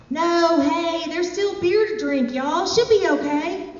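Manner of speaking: high-pitched calling-out protest. Acted. Philip calling campers back to drink beer, protesting. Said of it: "Philip was having none of it".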